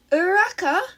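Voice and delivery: loud call from outside; a friend calling her awake from outside; yelling a name. A narrator's reading. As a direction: shout her name up to the window. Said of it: "she heard Izuku yell".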